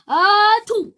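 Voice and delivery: loud ah then choo; a big acted sneeze. Another sneeze.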